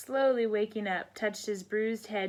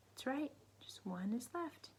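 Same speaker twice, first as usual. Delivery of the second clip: whisper; 3 semitones higher, 12 dB quieter than usual. Real, not acted. Whispered answer to children.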